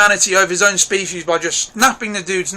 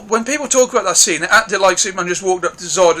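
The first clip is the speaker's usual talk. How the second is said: usual speaking voice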